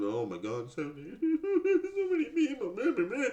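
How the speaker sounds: high, laughing parody of a panicky mom; mocking anxious mother.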